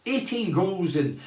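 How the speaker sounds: ordinary talk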